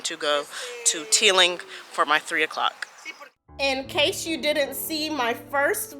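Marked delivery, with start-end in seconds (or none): 3.6-6.0 calm, soft narration over music; gentle storytelling voiceover; vlog voiceover. Acted.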